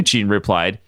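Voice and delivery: ordinary delivery, nothing marked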